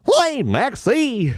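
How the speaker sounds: very high, slow, drawn-out cartoon voice; high goofy cartoon holler. Shown as a demonstration. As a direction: stretch it out in a high, silly cartoon call. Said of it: "in the voice of Goofy".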